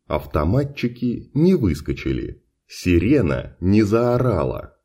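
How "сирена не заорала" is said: ordinary delivery, nothing marked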